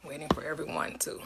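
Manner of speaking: whispered aside, unvoiced; whispering. Real, not acted. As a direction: breathe it out under your breath. Host murmurs while waiting.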